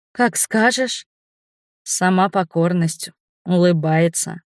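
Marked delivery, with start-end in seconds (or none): 0.1-1.1 higher female voice; voiced young woman. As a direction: answer as the compliant woman. Voices Inga's submissive reply. (женский голос, покорно)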